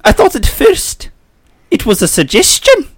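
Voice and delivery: Professor McGonagall. Acted. Co-host reviewing the cube as the professor, McGonagall. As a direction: deliver it high and measured as a schoolmistress. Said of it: "Take it away, Professor McGonagall".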